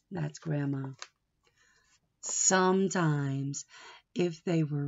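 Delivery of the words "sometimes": usual speaking voice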